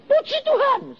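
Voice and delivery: high, sing-song mock praise; feigned eager piety; a high, ironic mock hallelujah; mock pious.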